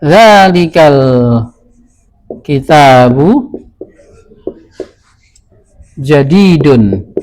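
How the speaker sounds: deliberate slow model phrase; slow teaching model. Shown as a demonstration. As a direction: say it slowly, word by word. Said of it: "kalau buku itu adalah baru".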